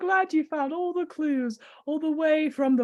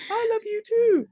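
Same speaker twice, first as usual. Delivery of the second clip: ordinary talk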